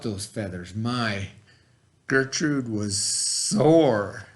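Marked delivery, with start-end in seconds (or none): none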